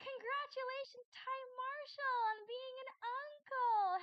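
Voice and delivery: gasp, then high, slow, loud and beaming; excited congratulations. Real, not acted. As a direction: burst out with delighted congratulations. Excited congratulations to chat viewer.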